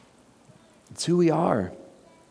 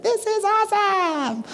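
much higher, slow, gushing delight; mock delight; slower than usual. Preacher imitating a listener at a high point, gushing delight. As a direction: gush like everything is perfect. Pretend delight in sermon example.

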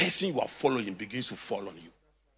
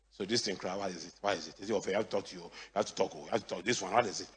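mock mumbling; 7 semitones lower, 9 dB quieter, faster than usual. Imitating mumbling refusers.

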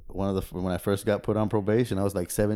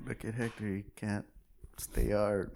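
soft, hushed teasing; quiet mock taunt; 11 dB quieter than usual. Cop mocking arrested Hector, hushed teasing. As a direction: murmur the joke under your breath.

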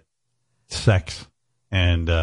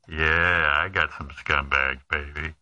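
low sleazy voice; 5 semitones lower, 5 dB quieter, slower than usual. Retold or imitated. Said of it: "the guy".

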